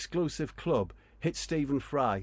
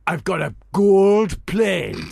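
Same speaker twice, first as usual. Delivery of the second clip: put-on loud, slow Pakistani accent, a bit higher; 7 semitones higher, 11 dB louder, much slower than usual. [name] boasting about his plane, in a Pakistani accent. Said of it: "Pakistani accent".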